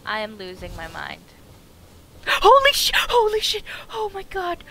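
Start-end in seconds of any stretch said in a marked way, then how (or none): 1.3-2.2 startled gasp. Real, not acted. Sudden scare. 2.3-4.6 much higher, loud scared yell; real startled shout at the wolf; startled shriek. Real, not acted.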